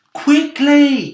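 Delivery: loud high urgent call; urgent cry. Acted. James warning the others of the hail, loud urgent cry. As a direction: shout it in alarm. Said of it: "cried James".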